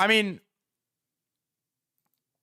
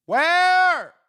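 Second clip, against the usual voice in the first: much higher, much louder yell; shout; 10 dB louder than usual. Real, not acted. Streamer demanding the announcement, loud yell.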